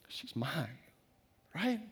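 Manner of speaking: hushed whisper, barely voiced. Real, not acted. Father holding his crying daughter, whispered. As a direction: breathe it out like a secret.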